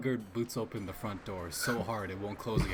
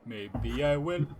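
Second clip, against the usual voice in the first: drawn-out tease; 4 semitones higher, 4 dB louder, much slower than usual. Acted. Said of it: "transition to the fucking [name]".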